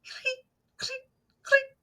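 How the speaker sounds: very high squeaky cleek; cricket chirp. A narrator's reading. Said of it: "The crickets go".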